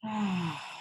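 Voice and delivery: long, audible exhale; deep breath out. Shown as a demonstration. Guide showing listeners a deep breath, long sighed exhale. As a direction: let a deep breath go in one slow sigh. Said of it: "we take a deep breath".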